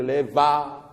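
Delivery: "les va", slowly, VA loud and held; stress on VA. Shown as a demonstration. Teacher showing how to read the verse, long loud stress on VA. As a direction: lengthen and push VA in "les va". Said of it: "quand je vais lire ce vers, je vais dire".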